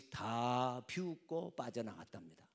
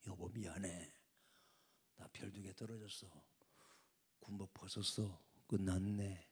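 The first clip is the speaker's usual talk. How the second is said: soft, lower, defeated words to his wife; quiet apology; 4 semitones lower, 9 dB quieter than usual. Acted. General telling his wife at night he lost his stars: soft, sad. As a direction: murmur the bad news gently.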